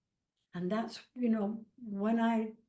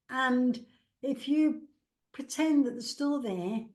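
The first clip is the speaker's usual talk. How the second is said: ordinary delivery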